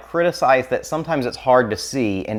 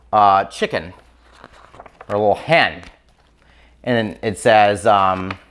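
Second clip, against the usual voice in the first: ordinary talk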